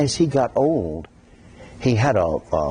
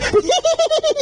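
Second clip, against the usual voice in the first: high squealing laugh. Shown as a demonstration. Laugh demos about voices.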